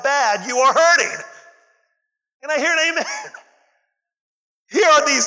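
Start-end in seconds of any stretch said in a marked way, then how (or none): 2.4-3.1 calling for amen. Real, not acted. Preacher calling to congregation.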